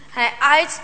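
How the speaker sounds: ordinary delivery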